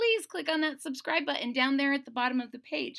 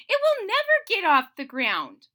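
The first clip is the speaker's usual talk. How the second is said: Toad's voice; 7 semitones higher, 8 dB louder than usual. Acted. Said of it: "he said".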